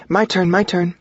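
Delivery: high, insistent female voice. A narrator's reading. Belle wanting to make the next toast, high and insistent. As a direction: jump in, high-pitched, claiming your turn. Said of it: "Belle insists".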